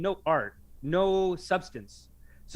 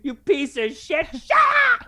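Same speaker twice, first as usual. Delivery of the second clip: imitated yelling; 10 semitones higher, 8 dB louder than usual. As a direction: bark it like an unhinged guru. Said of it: "he's just like".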